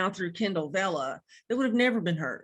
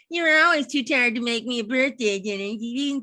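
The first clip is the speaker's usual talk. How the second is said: whiny daughter; 3 semitones higher, 4 dB louder than usual. Retold or imitated. Daughter's complaint voiced by parent.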